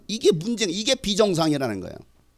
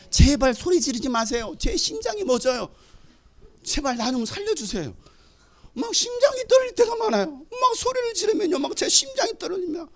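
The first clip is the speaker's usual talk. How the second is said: high, squeaky pleading; hammed-up timid fretting, pitched way up; mock frightened plea; 9 semitones higher than usual. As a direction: beg as if your heart can't take it. Mock plea against being shouted at.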